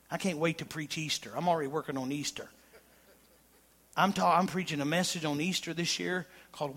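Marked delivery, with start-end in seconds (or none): none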